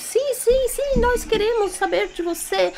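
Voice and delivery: high, eager cheer of yes; excited chorus. Acted. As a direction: answer like a happy crowd of animals. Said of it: "Todo mundo falou junto". (animais respondem juntos, animados e agudos)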